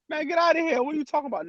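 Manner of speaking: high, teasing brush-off; mocking friend. Friend laughing off a request for a hug, teasing.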